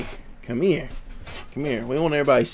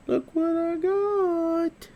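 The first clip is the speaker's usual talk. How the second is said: much higher, sing-song voice for the dog; high dog-talk voice; much slower than usual. Acted. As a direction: coo it up high to tempt the puppy. Showing treats to his dog.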